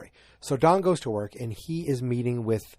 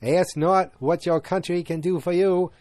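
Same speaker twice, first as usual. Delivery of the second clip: put-on Boston accent, raised and louder oratory; John F. Kennedy; 6 semitones higher, 4 dB louder than usual. Host mocks overdone Boston accents, mimicking a presidential Boston accent. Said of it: "trying to talk like ... like John F. Kennedy".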